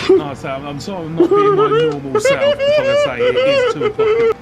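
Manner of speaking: high and loud; loud excited apology. Real, not acted. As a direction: blurt it out. Apologising loudly about the hour.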